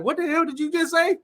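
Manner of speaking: high, startled put-on voice; mock shocked friend. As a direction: play the baffled Chicago buddy. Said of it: "They was like".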